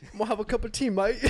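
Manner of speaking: put-on laughing English accent; tea-mate English accent, breaking up. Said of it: "Jose, can you do yours".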